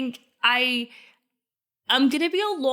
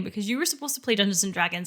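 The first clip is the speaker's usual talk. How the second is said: ordinary talk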